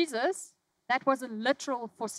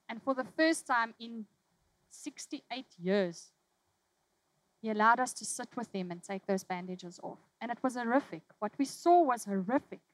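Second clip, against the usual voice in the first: ordinary talk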